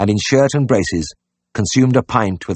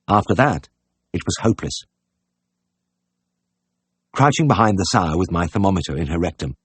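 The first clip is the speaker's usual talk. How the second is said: ordinary delivery, nothing marked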